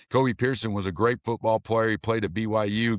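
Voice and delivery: usual speaking voice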